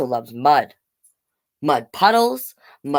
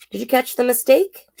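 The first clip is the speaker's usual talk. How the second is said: plain reading voice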